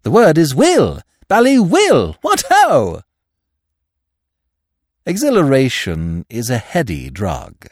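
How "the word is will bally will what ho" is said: loud, high, exuberant exclamations; rousing, buoyant cheer. A narrator's reading. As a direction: climb higher and louder in jubilant confidence. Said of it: "said Freddy buoyantly".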